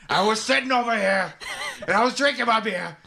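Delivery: high, shouted movie-star impression; Al Pacino. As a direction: build to a hammed-up, high-pitched rant. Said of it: "Can you do an Al Pacino impression?".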